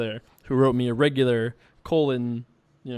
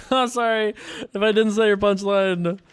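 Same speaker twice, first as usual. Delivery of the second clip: giggling through a higher, louder apology; laughing apology; 7 semitones higher, 3 dB louder than usual. Real, not acted. Apologizing to a viewer about a punchline, laughing.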